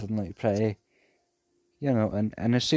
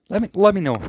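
sudden call-out; 10 semitones higher, 8 dB louder, faster than usual. Real, not acted. Exclaimed appeal to listeners.

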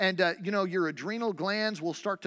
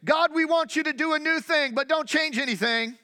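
high, loud whiny prayer; mocking church folk; 9 semitones higher, 6 dB louder than usual. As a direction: parody a stubborn congregation. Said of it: "in the church we say".

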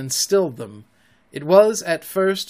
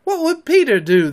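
high, loud cry; loud, high group-style exclamation; 10 semitones higher, 7 dB louder than usual. A narrator's reading. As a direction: call it out urgently, pitched up. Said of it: "they cried simultaneously".

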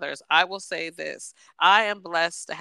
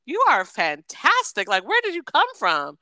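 impressed gush; 5 semitones higher, 4 dB louder than usual. Retold or imitated. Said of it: "you're like".

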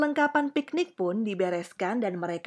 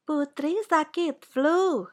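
high, slow, measured diagnosis; doctor character; 7 semitones higher, slower than usual. A narrator's reading. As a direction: pronounce it slowly as the doctor. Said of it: "kata Ibu dokter".

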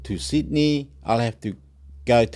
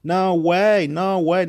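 emphatic denial; 7 semitones higher, 4 dB louder, slower than usual. Real, not acted.

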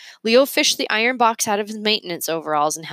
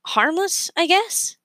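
put-on high, hesitant boy's voice; a boy groping for the word; unsure boy; 11 semitones higher, slower than usual. Leo to Mergenser, high and unsure.

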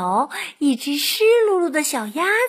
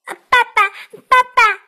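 high, loud little duckling; duckling calling out; 9 semitones higher, 8 dB louder, faster than usual. A narrator's reading. Duckling calls the fox daddy, high and loud. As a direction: cry out in a high, eager duckling voice.

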